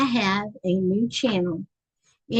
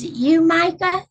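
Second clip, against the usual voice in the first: higher, louder eager question to a viewer; hopeful call-out; 8 semitones higher, 7 dB louder than usual. Real, not acted. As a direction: call his name, hoping it's him. Calling out to a viewer.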